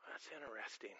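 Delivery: mock-indifferent aside; bored mock reaction. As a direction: play the listener who shrugs it off. Mocking an unmoved listener before God.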